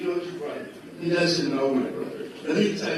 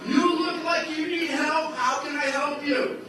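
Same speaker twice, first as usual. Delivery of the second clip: eager offer; 9 semitones higher, 4 dB louder than usual. Retold or imitated. Stranger eagerly offers help.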